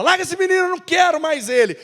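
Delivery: bem mais agudo e mais alto, irritado; Paulo irritado. Acted. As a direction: descartar o menino com impaciência. Paulo rejeita Marcos. (Paulo, irritado, rejeita Marcos diante de Barnabé)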